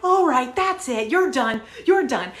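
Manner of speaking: loud, high dismissal; reenacted outburst with hard, final phrases; fed-up yelling. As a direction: throw someone out mid-argument. Escalated argument, throwing him out.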